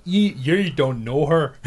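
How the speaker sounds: slow, badly faked Braveheart accent; awful put-on accent. Joking about a friend listening, awful Braveheart accent. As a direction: drag it out in a terrible Braveheart accent. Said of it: "That's an awful accent".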